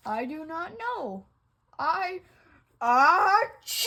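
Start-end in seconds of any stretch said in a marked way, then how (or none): 2.8-3.9 a loud, explosive fake sneeze; big sneeze. Gerald sneezing in front of Piggy, loud sneeze. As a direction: blast out a sneeze.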